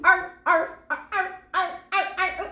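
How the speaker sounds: high, yelping arch like a bark. Shown as a demonstration. Reader chops the word into high barks. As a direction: bark out the syllable. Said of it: "Sounds like I'm barking".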